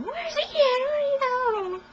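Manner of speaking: high-pitched mock scream; retold yelling. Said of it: "like, screaming and yelling".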